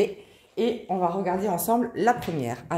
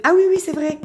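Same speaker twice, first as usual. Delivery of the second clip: sudden realisation; 10 semitones higher, 7 dB louder than usual. Real, not acted. Suddenly remembers the collection.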